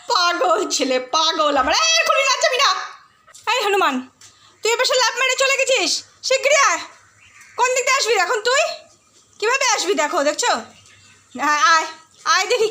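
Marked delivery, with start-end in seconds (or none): 1.8-9.9 high-pitched teasing scold to the dog; high pet-scolding voice; playful scolding. Real, not acted. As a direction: tell off the pet, half laughing. Scolding dog who jumped away.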